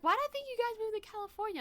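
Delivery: fast, high puzzled aunt imitation; mock kooky aunt. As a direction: play the confused aunt, quick and high. Aunt's line retold.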